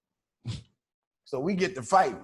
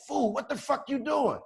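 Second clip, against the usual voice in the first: high, raised yell; shouting friend; 10 semitones higher than usual. Retold or imitated. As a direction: call out in alarm. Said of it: "he like".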